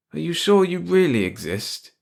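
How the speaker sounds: slow, high-pitched disbelief; incredulous. A narrator's reading. Stunned officer questions the mouse.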